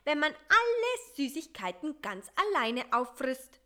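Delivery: loud gloating told-you-so. A narrator's reading. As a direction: rub it in with open glee. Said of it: "mit unüberhörbarer Schadenfreude".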